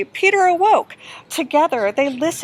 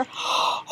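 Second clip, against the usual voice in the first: sharp frightened gasp; 6 dB quieter than usual. Acted. Snake appears.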